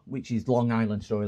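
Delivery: ordinary talk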